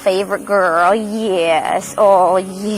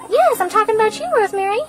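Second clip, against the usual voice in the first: much higher sing-song pet voice; cooing at a hen; 13 semitones higher, faster than usual. Real, not acted.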